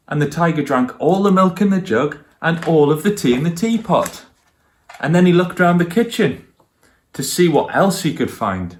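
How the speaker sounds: lively storybook narration for little listeners; reading to children. A narrator's reading. Grown-up reading a picture book aloud to children, lively storytelling. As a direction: tell it warmly like a bedtime book.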